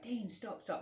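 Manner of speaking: high, pleading cry of stop. Retold or imitated. On the sofa, begging Dean to stop, high pleading. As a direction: beg him to stop, pitch rising. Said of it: "I was like".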